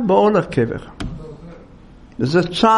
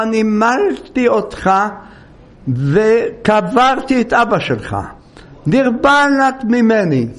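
higher, emphatic warning, acted out; retold threat; 5 semitones higher than usual. Rabbi warning the driver who will not stop, retold threat. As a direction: put him in his place as the imitated rabbi.

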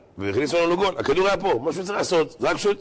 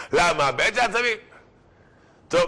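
ordinary talk, nothing marked

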